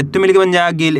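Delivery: ordinary talk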